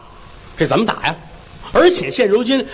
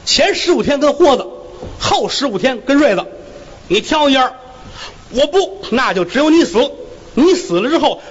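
a slightly raised, officious magistrate's voice; court magistrate; 6 semitones higher than usual. Acted. Magistrate warning the onlookers in the courtroom, officious voice. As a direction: lay down the rules from the bench.

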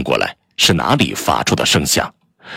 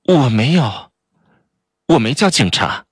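higher, lightly pleading; a touch of helpless protest; helpless denial; 4 semitones higher than usual. Acted. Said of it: "无奈地说".

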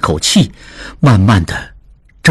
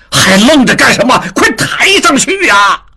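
higher, shouted order; shouting; 11 semitones higher than usual. A narrator's reading. Father shouts at servants to carry the daughter up. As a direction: bark at the servants to move. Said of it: "他冲翠儿等喊道".

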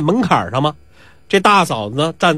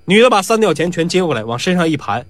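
ordinary delivery, nothing marked

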